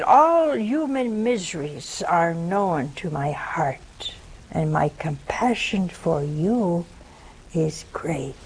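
slow, drawn-out solemn reading; solemn quotation. Acted. Nun quoting the words of Jesus to listeners, solemn and slow. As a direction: let each phrase stretch out slowly.

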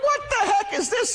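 very high, startled outburst; alarmed disbelief. Retold or imitated. Driver feeling his seat belt pull, alarmed disbelief. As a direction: blurt it out, voice shooting way up.

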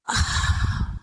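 long voiceless sigh; heavy sigh. Acted. Preacher imagines Peter meeting Jesus's gaze, heavy sigh. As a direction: let out a long, soft breath.

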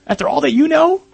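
accusing inner voice. Acted. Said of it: "this little voice is over here saying".

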